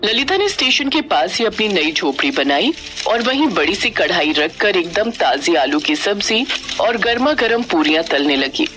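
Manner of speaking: usual speaking voice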